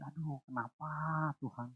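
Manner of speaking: hushed, defeated sigh to God; half-breathed lament; a quiet, worn-out plea; despairing sigh. Retold or imitated. Retold despairing cry to God.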